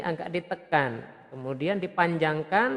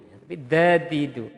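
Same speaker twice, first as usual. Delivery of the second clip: ordinary talk, nothing marked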